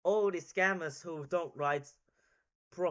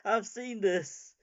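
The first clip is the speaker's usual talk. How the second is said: ordinary talk, nothing marked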